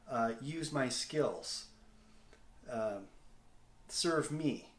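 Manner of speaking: ordinary talk, nothing marked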